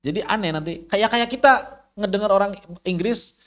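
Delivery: ordinary talk, nothing marked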